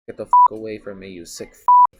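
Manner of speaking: loud, fast panicked yell; furious shout. Acted. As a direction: scream him off you as you grab the spray. Attacked by stranger, pepper spray.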